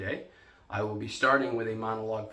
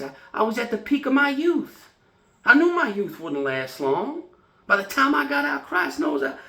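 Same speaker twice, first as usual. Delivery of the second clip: worked-up, high-pitched bursts that drop away at the end; agitated fading golden boy; 8 semitones higher, 5 dB louder than usual. Acted. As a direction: protest your lost youth, pitch leaping up. Performs Sweet Bird of Youth monologue.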